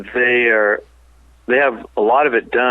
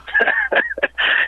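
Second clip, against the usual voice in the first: laughing. Retold or imitated. Nurse's laugh, retold.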